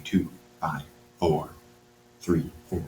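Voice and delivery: murmured softly to oneself; softly counting off answers under your breath; quiet self-talk. Shown as a demonstration. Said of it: "saying them quietly to myself".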